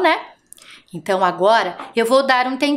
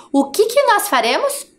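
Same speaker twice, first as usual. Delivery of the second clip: normal talking voice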